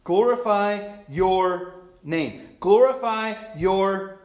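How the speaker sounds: very slow, word by word; emphatic proclamation. Acted. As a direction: draw out each word, dropping on the last. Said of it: "Jesus says".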